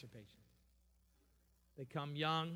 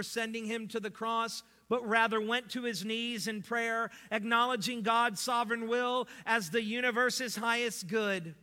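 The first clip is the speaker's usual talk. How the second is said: loud, high pulpit delivery; forceful preaching; 7 semitones higher, 11 dB louder than usual. Real, not acted. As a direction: preach it out to the congregation.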